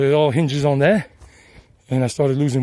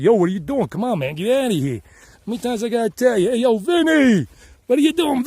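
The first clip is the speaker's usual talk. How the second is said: put-on brash New York City accent, pitch climbing higher and higher; hammed-up New York accent calling out to a buddy; 8 semitones higher than usual. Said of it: "then I picked up on the Yankee accent".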